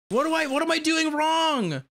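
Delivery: high exasperated yell; frustrated shout. Real, not acted. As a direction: cry out in frustration. Frustrated at the game.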